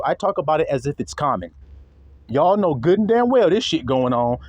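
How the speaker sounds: normal talking voice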